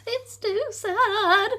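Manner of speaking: slow tearful little dragon; sad. Acted. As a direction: let it wobble with sorrow. Dragon crying in his cave.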